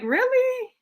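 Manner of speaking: high, startled "Really?"; surprised. Retold or imitated. Lady hearing a compliment, surprised high squeak. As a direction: squeak it out in disbelief. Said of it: "she was like".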